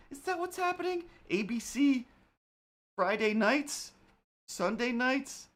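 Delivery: squeaky high mocking questions. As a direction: pitch way up, mocking. Ranting mockery of reference.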